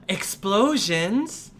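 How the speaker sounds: gasp, then high, slow, alarmed question; a kid shocked by the word; startled child. Acted. Girl hearing about explosions, gasping high and slow.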